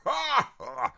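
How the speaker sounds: very high mock cackle; gleeful put-on laugh, falling away; Darrell Hammond's Connery laugh. Contestant pleased with his own jab, high mock laugh. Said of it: "that's Darrell's thing".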